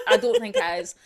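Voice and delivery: high, laughing backtrack on "I don't think it is". Real, not acted. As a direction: giggle it out, admitting you made it up. Laughs at own claim.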